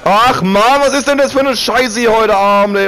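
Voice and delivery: loud, exasperated gamer rant; frustrated outburst. Real, not acted. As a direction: vent loudly at the race. Cursing after ruined race.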